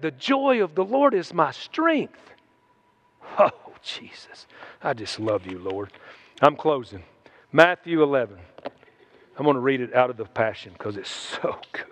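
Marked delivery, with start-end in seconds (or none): 3.4-5.9 hushed, intimate aside to God; murmured to Jesus mid-sermon, nearly whispered; whispered prayer. Real, not acted. 9.4-11.9 half-whispered, low aside; whispering to himself while finding the verse. Real, not acted.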